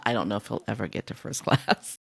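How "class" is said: normal talking voice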